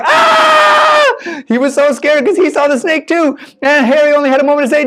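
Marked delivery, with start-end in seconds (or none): 0.0-1.1 high-pitched shout of fright; scream. Acted. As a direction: let out a sudden high scream. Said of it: "started screaming".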